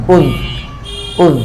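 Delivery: "উজ" with lips rounded on the u; rounded pesh vowel. Shown as a demonstration. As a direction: round the mouth fully for the vowel of "উজ". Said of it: "পেশের উচ্চারণে মুখ গোল হয়".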